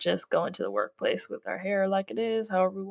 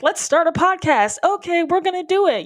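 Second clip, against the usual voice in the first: acted eagerness; 8 semitones higher than usual. Imagining herself deciding to start a podcast, eager. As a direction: pitch it up like a keen go-getter.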